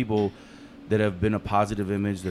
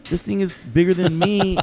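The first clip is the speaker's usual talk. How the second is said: mock scared; 10 semitones higher, 6 dB louder than usual. His scared reply to cousins.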